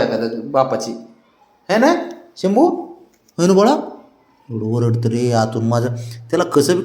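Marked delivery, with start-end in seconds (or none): 1.7-3.7 high, loud sing-song greeting to the dogs; high pet talk. Real, not acted. Man greeting his excited dogs at the door, high sing-song pet talk. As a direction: gush over the excited dog in a high voice. Cooing to the dog.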